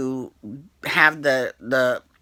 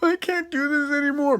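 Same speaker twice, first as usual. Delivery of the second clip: high whiny mock panic, much higher; mock scared; 12 semitones higher than usual. Imagining Scott pushed off the bungee, high mock whine. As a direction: play the coward for a laugh. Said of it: "that would have been hilarious".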